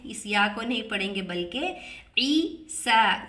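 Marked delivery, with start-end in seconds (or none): 2.2-3.3 ईसा with सा held for two counts; long vowel. Shown as a demonstration. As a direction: stretch the सा in ईसा. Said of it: "दो हरकत करके लंबा पढ़ेंगे". (ईसा, सा दो हरकत लंबा)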